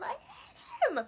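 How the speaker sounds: hammed-up fake sob over the hatred; mock whiny cry.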